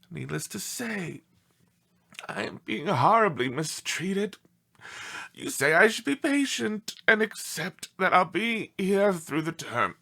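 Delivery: usual speaking voice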